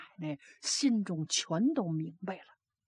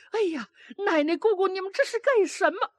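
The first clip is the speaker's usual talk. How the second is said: much higher, fast alarmed cry; alarmed child; 10 semitones higher, faster than usual. A narrator's reading. Child runs in to break up grandmother and aunt's fight, higher and fast. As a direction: rush in and shout to stop them.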